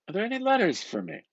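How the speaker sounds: eager clerk-window question; asking for letters. A narrator's reading. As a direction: perk up and ask quickly at the counter. Bloom asks the postmistress.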